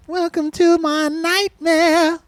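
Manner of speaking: high, slow spooky sing-song; giggly sinister sing-song. Pretend. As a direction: croon it like a creepy carnival host. Spooky jingle imitation.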